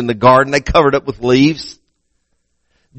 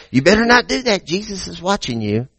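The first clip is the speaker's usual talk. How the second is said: scolding parent; 3 semitones higher, 5 dB quieter than usual. Retold or imitated. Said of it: "sometimes we scare our kids".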